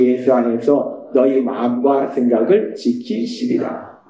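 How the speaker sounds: slow, solemn reading of the verse; scripture reading. Real, not acted. Pastor leads the congregation reading scripture, slow and solemn. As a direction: read the scripture slowly and reverently. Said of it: "같이 읽겠습니다. 시작".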